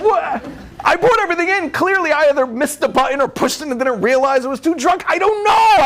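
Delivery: high, exasperated self-defence; husband answering his wife about the garage; defensive husband. Retold or imitated. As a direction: protest like a baffled husband.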